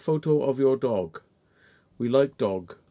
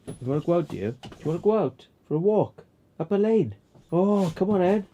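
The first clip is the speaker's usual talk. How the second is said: high excited talk to the dog; high-pitched walkies talk; 6 semitones higher than usual. Real, not acted. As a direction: hype the dog up.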